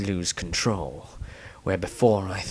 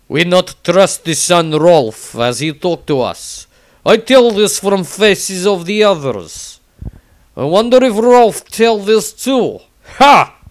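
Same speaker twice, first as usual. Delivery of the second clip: much higher, much louder gruff character voice; Tordrad's voice; 8 semitones higher, 10 dB louder than usual. Acted. Tordrad loudly reporting distrust of Rolf. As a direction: belt it out as a brash broken-English warrior. Tordrad's diary in broken speech.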